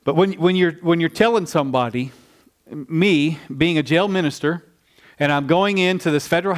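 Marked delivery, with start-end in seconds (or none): none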